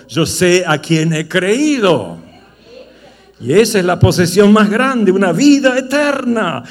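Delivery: loud, driving sermon voice; fiery preaching. Acted. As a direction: preach it full force to the church. Preaching at full force. (predicación a todo volumen)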